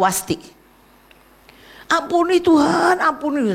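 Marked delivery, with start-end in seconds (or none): 1.9-3.5 high, slow begging for mercy; a retold tearful cry for forgiveness; crying plea. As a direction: plead through mock tears. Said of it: "Udah nangis".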